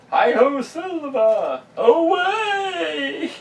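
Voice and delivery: high, loud goofy rallying cry; Jim Carrey impression. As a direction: shout it like a cartoon hero. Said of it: "what I'm quoting is, uh, Jim Carrey in Ace Ventura".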